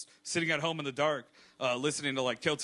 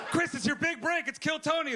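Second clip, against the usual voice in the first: mock hype voice; 10 semitones higher than usual. Mock announcer bit.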